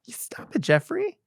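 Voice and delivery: very high cooing scold; doting mother. Retold or imitated. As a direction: playfully chide the baby in a gushing mom voice. Mock mother scolding baby.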